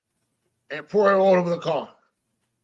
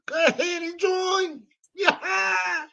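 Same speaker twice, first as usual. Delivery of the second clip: high, slow, drawn-out hype; hyped shout; 11 semitones higher, much slower than usual. Real, not acted. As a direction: pump up the viewers.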